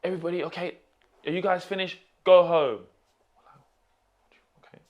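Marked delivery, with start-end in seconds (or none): 0.0-2.8 high-pitched mock boss, building to a loud dismissal; imitated brusque manager. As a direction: act out a manager sending staff away, louder at the end. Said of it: "the manager came over and he goes".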